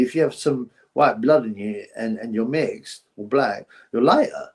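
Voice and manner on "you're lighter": normal talking voice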